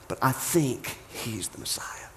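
slow, soft, near-whispered confession; hushed confiding. Acted. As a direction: confide it slowly, almost in a whisper. Brother confiding a daring belief.

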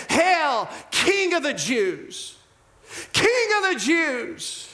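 mocking hail. Soldiers kneeling before Jesus to jeer, mocking. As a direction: crow it slowly in mock homage. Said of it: "they mocked him".

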